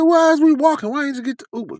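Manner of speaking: high-pitched indignant questions; mock put-out griping; retold complaint. Acted complaint about walking.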